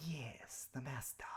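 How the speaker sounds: soft hissing serpent whisper; sly snake creature voice. Acted. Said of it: "I have to speak with the snake voice".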